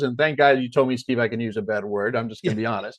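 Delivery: normal talking voice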